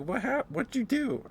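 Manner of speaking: high, loud, stunned what-happened; mock alarm at a friend's stunt; shocked question. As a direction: blurt it in high disbelief. Party shocked after knockout.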